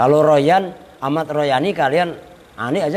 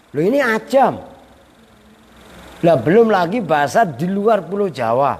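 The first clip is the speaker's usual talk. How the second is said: ordinary delivery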